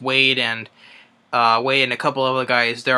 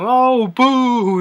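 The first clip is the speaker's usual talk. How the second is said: high-pitched, louder booing heckler; mock heckler boo; 11 semitones higher, 6 dB louder than usual. Heckler in the crowd booing the whale, mock jeer. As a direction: jeer like a bored crowd member.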